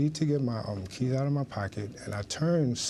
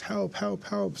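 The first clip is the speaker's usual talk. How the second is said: retold cry for help; 9 semitones higher, slower than usual. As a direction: re-enact calling for help in a raised pitch. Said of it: "so I just start screaming".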